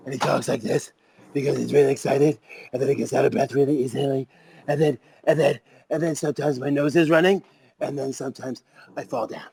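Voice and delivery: lightly voiced excited kid rambling on. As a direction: ramble like an overeager little kid. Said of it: "sounds like a pissed off Trump".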